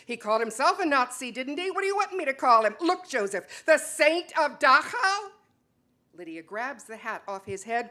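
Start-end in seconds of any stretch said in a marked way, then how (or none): 0.1-5.3 sharp, rising anger, louder and higher; angry. Acted. Wife snapping back at husband about his uncle, angry. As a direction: lash out at him in bitter accusation. Couple's heated quarrel.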